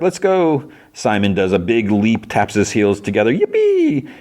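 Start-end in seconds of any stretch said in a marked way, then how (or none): 0.0-0.7 high, drawn-out mock cheer. Onlooker as everyone cheers the hug, light cheer. 3.3-4.1 very high, held squeal of glee; gleeful cheer. Retold or imitated. Simon leaping and tapping his heels together, gleeful cheer.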